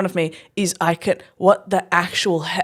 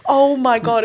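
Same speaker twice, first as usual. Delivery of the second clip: usual speaking voice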